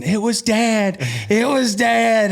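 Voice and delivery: high, loud, joyful cry, slowing at the end; excited yell. Retold or imitated. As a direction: shout it jumping up and down. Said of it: "she's yelling".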